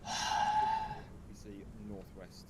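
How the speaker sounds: slow, loud call of northwest; loud drawn-out callout. Real, not acted. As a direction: stretch it out loudly over the radio. Radio callout, direction asked.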